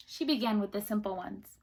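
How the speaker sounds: usual speaking voice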